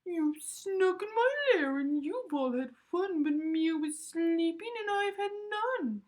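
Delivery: high, loud, whiny bear complaint; grumpy bear. A narrator's reading. Bear complaining to the guests about missing fun. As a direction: sulk loudly like a woken bear.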